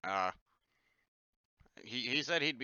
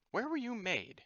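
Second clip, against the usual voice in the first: much higher, marvelling; curious high question; 13 semitones higher than usual. Acted. Stranger marvelling at the warforged's making, high voiced. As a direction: ask it in awe.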